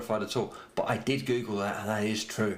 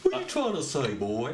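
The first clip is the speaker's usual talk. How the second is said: dog voice; 2 semitones higher than usual. Acted. Dog questioning its owner, comic puzzled voice. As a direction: voice a baffled dog talking back.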